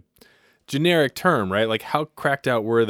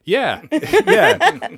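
laughing yeah; 7 dB louder than usual. Real, not acted. Laughing agreement.

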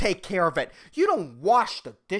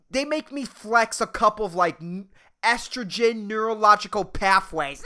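ordinary talk, nothing marked

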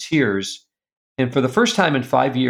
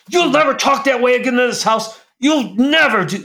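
high, loud, raging shout at his son; furious yell; 13 semitones higher, 5 dB louder than usual. Retold or imitated. Father yelling at his disrespectful son, furious shout. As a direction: bellow it like you've lost all control. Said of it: "I yell like I've never yelled before".

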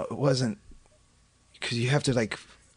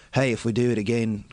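a second, nasal try at an Australian accent; Australian accent attempt. Shown as a demonstration. Comic failing an Australian accent for a friend. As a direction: give up midway through an Australian accent. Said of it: "I can't fucking do it".

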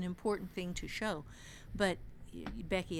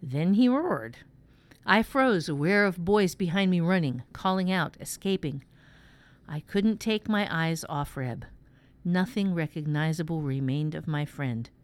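measured first-person story reading; dramatic audiobook narration with voiced dialogue; 10 dB louder than usual. A narrator's reading. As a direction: read a tense night-time tale aloud. Reads fiction aloud.

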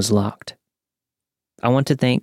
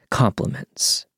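whispered; slower than usual. A narrator's reading. Sarcastic echo of muttered insults.

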